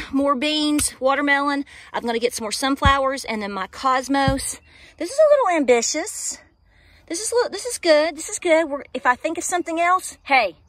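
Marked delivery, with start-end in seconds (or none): 5.0-8.7 excited. Real, not acted. Reacting to an ambitious plan.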